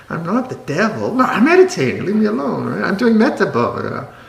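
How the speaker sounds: fussy offended monk voice, mock-righteous; mock precious monk. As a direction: parody a touchy monk guarding his meditation. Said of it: "monks get that way sometimes".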